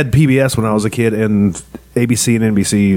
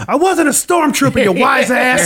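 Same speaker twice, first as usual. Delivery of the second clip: much higher, snotty character voice; high mock sharpshooter voice; 13 semitones higher, slower than usual. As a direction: squeak it out as the offended sniper. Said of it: "He said".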